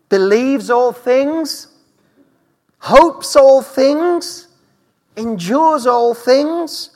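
slow, drawn-out emphatic reading; savoring each phrase. Acted. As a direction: linger on every word, rising in awe. Savoring scripture list slowly.

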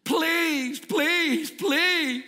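high, pleading chant of please; whiny begging. Acted. Ben begs the manager for free coffee, high and whiny. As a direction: beg like a child for a treat.

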